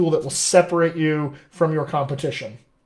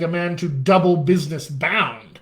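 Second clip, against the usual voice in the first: normal talking voice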